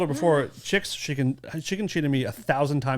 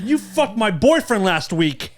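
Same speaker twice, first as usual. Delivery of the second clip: mock accusation; 6 semitones higher, 4 dB louder, much slower than usual. Acted bar-fight accusation, slowed.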